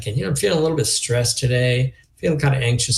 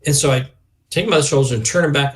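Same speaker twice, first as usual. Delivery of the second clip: ordinary delivery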